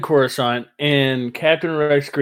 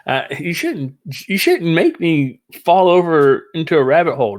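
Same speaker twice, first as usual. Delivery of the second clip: ordinary talk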